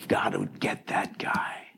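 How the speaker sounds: hushed, slow conspiratorial aside; a sly whispered aside to the crowd. Acted. Aside about the thief.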